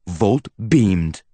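ordinary delivery

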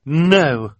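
blunt defiant no; defiant woman. A narrator's reading. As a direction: refuse him flat.

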